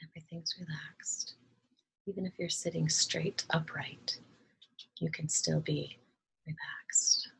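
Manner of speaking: calm, soft meditation voice with long pauses; unhurried, hushed instructions, leaving space to breathe; guided meditation. Acted. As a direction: gently guide listeners through relaxing each body part.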